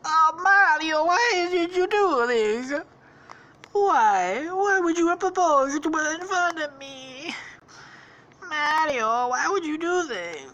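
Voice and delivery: hammed-up heartbroken falsetto wail; Luigi. Jilted brother protesting the proposal, mock anguished wail. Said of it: "And then Luigi's like".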